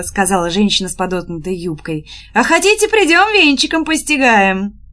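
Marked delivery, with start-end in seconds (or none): 2.3-4.8 high, sing-out teasing offer; teasing call. A narrator's reading. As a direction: call out a cheeky offer to the lads. Woman teasingly calls out to soldiers. (задорный высокий окрик)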